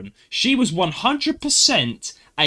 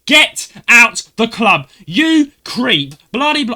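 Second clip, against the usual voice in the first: imitated full-force yell, high, slow and very loud; shouting; 8 semitones higher, 11 dB louder, much slower than usual. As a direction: hammer out each word in a high angry bellow. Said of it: "she was shouting, like".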